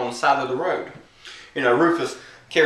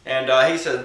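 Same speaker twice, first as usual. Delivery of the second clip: ordinary delivery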